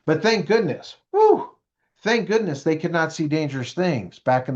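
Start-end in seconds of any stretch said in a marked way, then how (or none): 1.1-1.5 high, loud whew of relief. Pretend. Speaker thanking goodness, exaggerated relieved whew. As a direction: blow out a big, high "whew".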